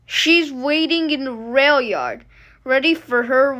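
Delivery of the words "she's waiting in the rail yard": ordinary delivery, nothing marked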